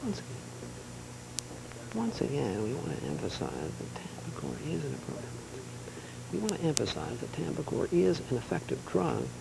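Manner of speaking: softly practised anchor delivery; subdued, measured broadcast read; news read rehearsal. Pretend. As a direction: run through the report under your breath.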